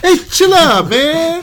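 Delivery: high put-on dude voice; goofy high chill-bro voice; mock tourist voice. Mock voice in movie recap.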